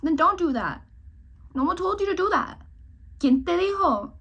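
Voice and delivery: high, loud mock telling-off; sassy scolding. Real, not acted. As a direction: snap back at the whiner with sass. Scolding her imagined self.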